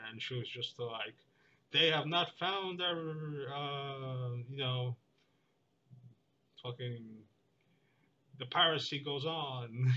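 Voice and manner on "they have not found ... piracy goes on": slow, higher, louder pirate growl; mock pirate. Retelling a woman's pirate bit, slow mock pirate voice. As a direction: hams up a pirate quoting a jail video. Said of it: "she was imitating a pirate".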